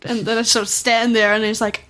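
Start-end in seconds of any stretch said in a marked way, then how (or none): none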